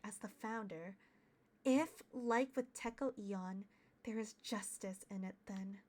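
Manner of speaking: quiet, measured inner monologue; story narration. Acted. Detective steeling for the interrogation, hushed measured narration. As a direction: narrate with restraint and resolve, softly at the phrase ends.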